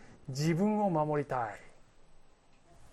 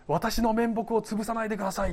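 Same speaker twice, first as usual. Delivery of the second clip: mock plea; 5 semitones higher than usual. Acts Saul pleading to save face.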